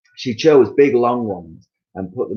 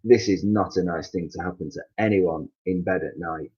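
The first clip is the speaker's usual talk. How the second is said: usual speaking voice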